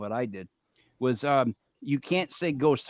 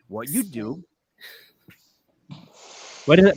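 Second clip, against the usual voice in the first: normal talking voice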